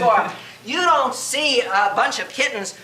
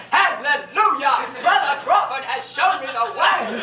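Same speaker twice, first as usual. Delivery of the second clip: revival-meeting convert; 4 semitones higher than usual. Acted. Audience member raving in the hall after the lecture, mock-evangelical testimony. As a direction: testify like a converted believer, hammed up.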